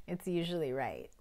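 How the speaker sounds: chuckling through the words; laughing. Real, not acted. Laughs while advising.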